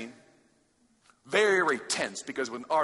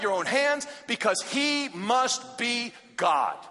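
preach; 5 semitones higher than usual. Acted. Preacher pressing students toward God's call, fervent. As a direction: drive the call home to the students.

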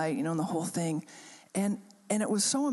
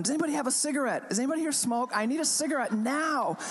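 higher, fast call across the church; urgent calling out; 7 semitones higher, faster than usual. Retold or imitated. Pastor hunting a cigarette for a crying girl, urgent call. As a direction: hurry through the crowd asking for a cigarette.

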